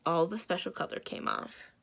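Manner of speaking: ordinary delivery, nothing marked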